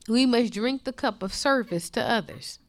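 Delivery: usual speaking voice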